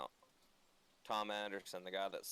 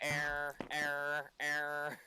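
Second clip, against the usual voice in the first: higher stuck-loop error chant; glitching robot; 6 semitones higher, 4 dB louder than usual. Pretend. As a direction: repeat it like a crashing machine.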